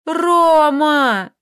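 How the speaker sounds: put-on a girl shouting her lover's name in terror; terrible cry. A narrator's reading. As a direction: scream the name in horror. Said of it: "закричала она страшно, сипло".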